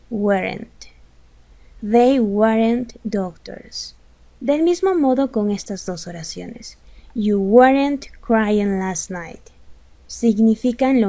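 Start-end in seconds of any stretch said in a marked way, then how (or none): 1.8-3.9 each word spelled out slowly for learners; slow model sentence. Shown as a demonstration. Teacher modeling an English sentence for Spanish speakers, slow and clear. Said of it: "teacher models English sentence". 7.1-9.4 each word spelled out slowly for learners; slow model sentence. Shown as a demonstration. Teacher modeling an English sentence for Spanish speakers, slow and clear. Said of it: "teacher models English sentence".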